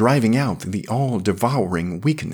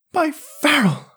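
gasped laughing; 3 dB louder than usual. A narrator's reading. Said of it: "gasped Yarrell, choking into his mug".

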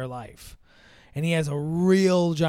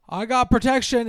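high, louder mock-eager announcement; eager excitement; 7 semitones higher, 5 dB louder than usual. Boyfriend jumps up at her maybe, eagerly excited. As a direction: blurt it out keenly, imitating him.

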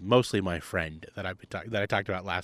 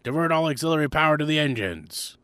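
starship captain order; 6 semitones higher than usual. Pretend. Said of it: "Different movie".

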